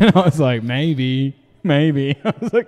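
laughing through the retelling; cracking up while telling the story. Real, not acted. Laughing at the Uber driver's offer.

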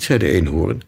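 usual speaking voice

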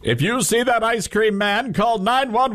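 high, slow emphatic reporter voice; mock reporter warning. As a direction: ham up the news warning. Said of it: "That's what the reporter said".

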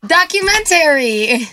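"Documentary" slowly, with a full "-tary" ending; weird pronunciation. Shown as a demonstration. Showing co-hosts how she says documentary weird, drawn-out pronunciation. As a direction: stretch "Documentary" out, sounding every syllable to "-tary". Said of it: "I've been told I say documentary weird".

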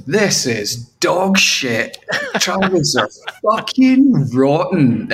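sneering critic. Retold or imitated. Critics trashing [name]'s album, slow and sneering. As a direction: drag it out with disdain. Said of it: "the critics come out and they're like".

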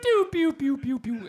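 imitating a descending arcade jingle, starting high and falling; game over jingle. Teller mimicking Tetris end game music, falling mock jingle. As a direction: sing the sad game over tune with your mouth. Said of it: "Tetris fucking end game music that just went".

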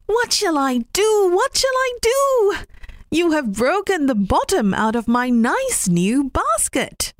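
high, rising wail of dismay; distressed rabbit. Acted. Rabbit lamenting his broken knife to old man, high and distressed. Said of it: "cried Mister Rabbit".

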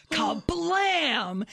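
high, punchy spoken blast; explosion imitation. Storyteller as the cognac ignites, punchy explosion noise. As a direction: act out a sudden fireball.

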